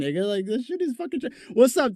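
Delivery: ordinary talk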